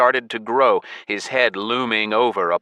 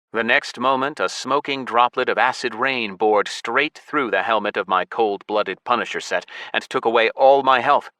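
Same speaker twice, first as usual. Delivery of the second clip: usual speaking voice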